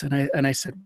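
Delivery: normal talking voice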